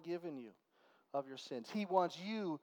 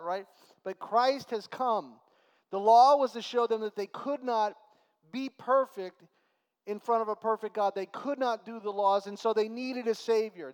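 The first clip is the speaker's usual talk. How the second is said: raised, louder pulpit preaching; preacher building to full force on the law; fervent preaching; 6 semitones higher, 8 dB louder than usual. Acted. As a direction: drive the point home to the congregation.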